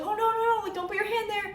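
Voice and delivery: high, urgent retold cry of no no no; alarmed warning. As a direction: warn the little kid off the door hinge, alarmed. Child's hand in closing door.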